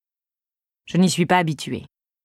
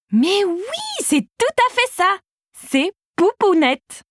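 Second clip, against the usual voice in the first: excited; 13 semitones higher, slower than usual. Acted. Marine's sudden excited realization.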